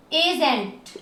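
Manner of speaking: एजेंट said apart, starting on "ay"; correct e reading. Shown as a demonstration. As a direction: give एजेंट a clear "ay" opening. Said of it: "क्या पढ़ोगे". (एजेंट, शुरू में साफ़ "ए")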